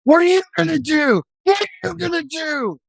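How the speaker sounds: high, harsh shouted demand; screaming at the officer from the back of the car; retold scream. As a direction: yell it like a raging prisoner in the back seat. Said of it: "And he's screaming".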